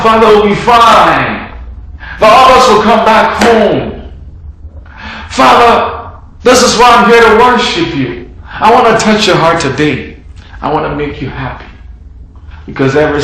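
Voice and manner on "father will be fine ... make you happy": fervent prayer. Acted. Believer comforting the grieving Father in worship, fervent. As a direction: lift it up to the Father.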